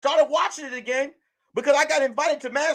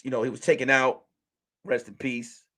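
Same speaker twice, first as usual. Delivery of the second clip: ordinary talk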